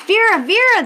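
high, loud anxious calls of the birds; crying out. Acted. The fussy birds calling out to Vera, high and loud. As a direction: cry her name out in alarm, high and loud. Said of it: "they cried".

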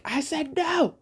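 high-pitched imitation of a yelling mother; mock yell. Irritated mom refusing her son, mock yelling. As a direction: mimic an exasperated yell without the volume. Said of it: "she yelled at him".

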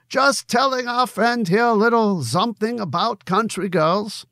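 Conrad the barkeep in a lightly put-on German accent; a loose, half-hearted German accent, friendly and teasing. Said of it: "for my German accent".

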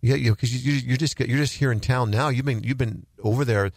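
ordinary talk

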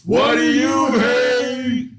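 high, slow, stretched-out shout; shouted catchphrase. Pretend. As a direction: holler it like a game-show chant. Shouted segment catchphrase call-back.

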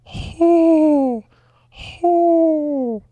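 high hooting owl call. Acted. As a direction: hoot like an owl, high and loud. Hooting for the Owls team.

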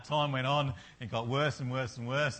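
normal talking voice

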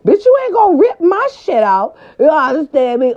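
high-pitched indignant protest; mock outraged warning. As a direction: warn them off in a high, comic fury. Mock warning to the machine operator.

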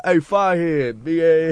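high, teasing jeer at someone; mocking call-out. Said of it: "they be like".

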